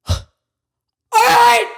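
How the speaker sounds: piercing high screamed "all right"; acted-out exasperated shriek; retold screaming. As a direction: scream it out, far above your talking voice. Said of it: "I, screaming like this".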